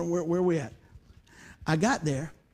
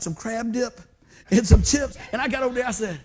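ordinary delivery

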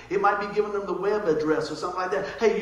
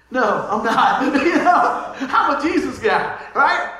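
laughing; 4 semitones higher, 9 dB louder, much slower than usual. Real, not acted. Laughing after the joke.

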